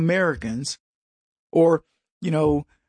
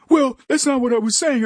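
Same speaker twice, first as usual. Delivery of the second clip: mock defensive voice; 6 semitones higher than usual. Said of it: "they're like".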